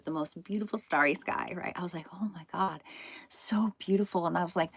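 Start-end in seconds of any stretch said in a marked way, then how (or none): 2.1-4.2 hushed, breathless wonder at the stars; awed whisper. Retold or imitated. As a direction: whisper it like you can't believe the sky. Awed under a starry sky.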